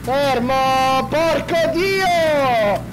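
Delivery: loud drawn-out curse; slow furious swear; frustrated curse. Real, not acted. Vehicle won't stop.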